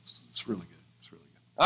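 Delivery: soft whispered affirmation; hushed, moved whisper. Real, not acted. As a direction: breathe the words to yourself. Tender whispered aside after image.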